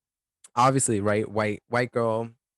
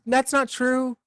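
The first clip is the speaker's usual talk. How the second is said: high protest; 12 semitones higher, 3 dB louder than usual. Real, not acted. Protesting loudly.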